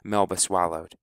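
ordinary delivery

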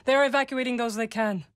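ordinary talk, nothing marked

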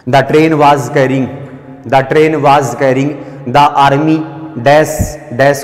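very slow, word by word for writing down; slow teacher dictation; dictation pace. Shown as a demonstration. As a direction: dictate it slowly so students can write. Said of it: "orally बोल रहा हूँ मैं".

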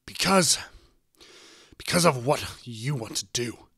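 loud, emphatic retort; heated arguing. A narrator's reading. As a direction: fire back in a quarrel. Parents in a shouted argument.

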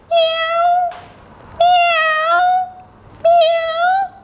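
high squeaky cat meows; kitty meows. Pretend. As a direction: meow like a playful kitten for the kids. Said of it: "Where are those kitty cat sounds?".

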